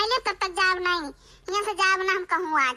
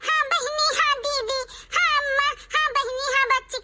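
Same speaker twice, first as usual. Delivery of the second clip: imitating sugary agreeable neighbour chat, louder; a hint of syrupy gossip voice; mock sweet talk; 4 semitones higher, 4 dB louder than usual. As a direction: parody her fake friendliness. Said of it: "करत थी वहीं".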